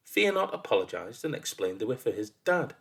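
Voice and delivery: ordinary delivery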